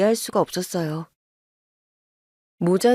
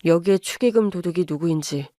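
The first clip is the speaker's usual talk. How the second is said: put-on lower, restrained young man apologizing; son-in-law apologizing to his wife's mother, lower and restrained; apologetic son-in-law; 5 semitones lower than usual. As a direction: hold it down, sorry but firm. Son voice again.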